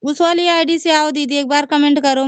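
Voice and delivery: excited. Real, not acted. Begging her sister to comment from the other account, eager.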